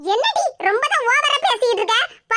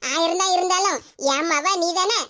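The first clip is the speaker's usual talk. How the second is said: usual speaking voice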